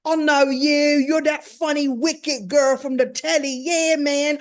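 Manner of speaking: boisterous British street greeting, slowed for emphasis; London street accent. Retold or imitated. Idris greeting the host, loud British street accent.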